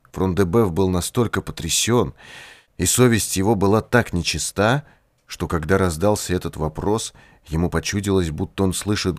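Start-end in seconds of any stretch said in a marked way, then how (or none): none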